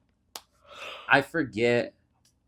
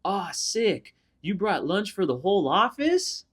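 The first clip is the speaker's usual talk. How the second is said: mock coworker jab; 7 semitones higher, slower than usual. Acting the coworker's mocking line.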